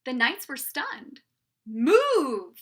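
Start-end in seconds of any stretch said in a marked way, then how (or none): 1.7-2.6 loud, drawn-out yell; storybook knights' shout; shouted order. A narrator's reading. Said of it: "they shouted".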